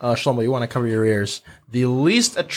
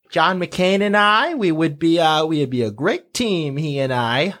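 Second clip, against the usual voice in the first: Sarah Palin; 4 semitones higher than usual. Acted. Politician praising her running mate, exaggerated Alaskan accent. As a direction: put on a folksy Alaskan accent. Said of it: "who had an Alaskan accent".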